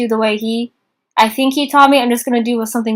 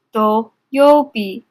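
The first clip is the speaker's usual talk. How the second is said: very slow syllable-by-syllable; much slower than usual. Shown as a demonstration. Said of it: "teacher stretches reading slowly".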